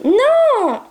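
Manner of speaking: sharp high shouted no; real startled shout at a disobedient puppy; sudden no. Real, not acted. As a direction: yelp it out at the dog. Puppy does the wrong thing.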